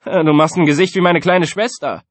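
high, loud taunt; scornful schoolkid, very high then dropping a little; sneering. A narrator's reading. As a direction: goad a rival before the class. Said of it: "fragte Antoine höhnisch".

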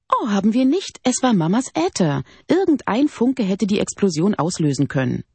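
put-on high, defensive young woman; indignant protest.

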